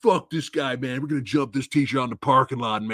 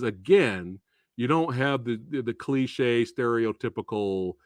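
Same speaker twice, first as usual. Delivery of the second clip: ordinary talk, nothing marked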